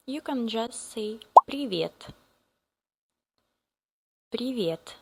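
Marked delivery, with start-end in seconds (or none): none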